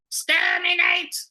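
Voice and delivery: loud, high, slow robotic shriek; Dalek. Shown as a demonstration. Showing off a sci-fi impression, loud robotic shriek. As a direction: screech it mechanically, stretching each syllable. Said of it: "I can do a really good Dalek".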